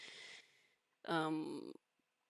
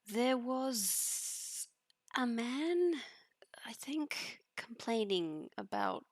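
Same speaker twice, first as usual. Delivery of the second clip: hesitant Phoebe; 7 semitones higher, much slower than usual. Acted. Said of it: "Phoebe will go".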